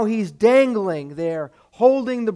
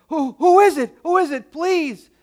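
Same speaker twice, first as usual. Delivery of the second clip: high, louder frightened plea; desperate pleading; 7 semitones higher, 5 dB louder than usual. Acted. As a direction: beg the voice to say who it is. Dangling man answers unseen voice.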